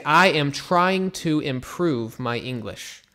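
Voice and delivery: slowly and clearly, word by word; careful teacher's model read; slow model sentence. Shown as a demonstration. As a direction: spell it out for learners. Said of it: "so you could say".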